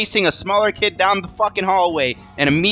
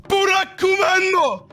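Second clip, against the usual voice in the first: sharp gasp, then very high drawn-out cry; high-pitched mock-horrified squeal; startled Japanese girl; slower than usual. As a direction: imitate a tiny girl recoiling in shock. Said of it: "she recoils and she goes".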